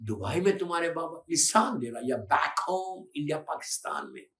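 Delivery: normal talking voice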